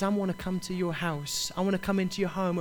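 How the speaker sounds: Jesus asking gently to come in, softly spoken; tender invitation. Acted. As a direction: quietly offer to come home with them. Preacher voices Jesus' gentle invitation.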